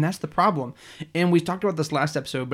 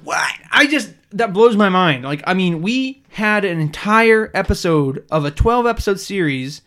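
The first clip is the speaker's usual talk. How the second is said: loud; 3 semitones higher, 9 dB louder than usual. Real, not acted. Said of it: "You're clipping a little bit".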